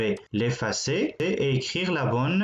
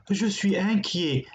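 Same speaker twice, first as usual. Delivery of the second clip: mock worried; 7 semitones higher than usual. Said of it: "Celui-là est inquiet".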